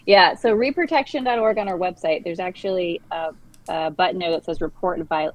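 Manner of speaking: ordinary talk, nothing marked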